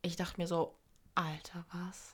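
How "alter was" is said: very soft, fading to a whisper; retold stunned disbelief. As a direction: recall the shock, almost voiceless. Retells shock at actor's death. (leise nacherzählte Fassungslosigkeit)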